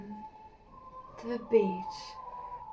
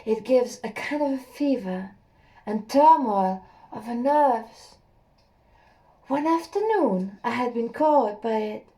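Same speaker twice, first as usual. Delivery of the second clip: plain reading voice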